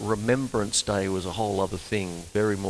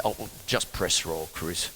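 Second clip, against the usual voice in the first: put-on lower, softer casual old man; old bandleader; 7 semitones lower, 6 dB quieter than usual. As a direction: toss it off like an easygoing bandleader. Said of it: "dear old [name] said".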